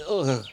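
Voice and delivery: gagging groan of disgust; loud disgusted ugh. Acted. Tastes the grotesque powder.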